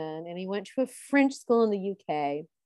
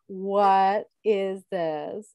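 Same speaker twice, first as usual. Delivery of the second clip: very slow, word-by-word disbelief; baffled disbelief; 2 semitones lower, much slower than usual. Retold or imitated. Reacting to the title socialite, drawn-out bafflement. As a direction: draw each word right out in mock bafflement.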